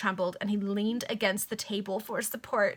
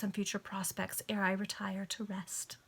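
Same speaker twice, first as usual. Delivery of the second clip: whisper; 8 dB quieter than usual. Acted. Whispering the heroine's reverie line.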